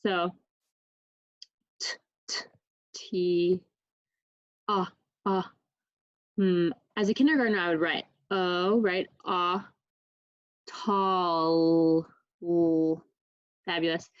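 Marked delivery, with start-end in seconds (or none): 1.8-6.7 t, t, ah sounded out letter by letter; sounding out words. Shown as a demonstration. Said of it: "I'm gonna sound out the word". 10.7-13.0 tall stretched long, then l alone; sounding out words. Shown as a demonstration. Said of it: "a good way to sound it out".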